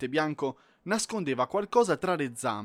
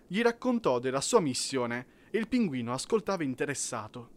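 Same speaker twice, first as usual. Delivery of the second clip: plain reading voice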